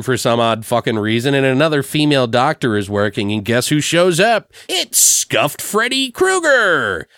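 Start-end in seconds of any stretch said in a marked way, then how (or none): 4.7-7.1 high, slow, drawn-out announcement; hammed-up big reveal; mock announcer reveal. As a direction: unveil the villain like a show host. Mock reveal of who shows up.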